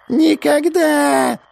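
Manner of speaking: high, very slow, sinister cry; sinister mavka cackle. A narrator's reading. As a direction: gloat it like wicked swamp nymphs. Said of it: "зловеще захохотали мавки".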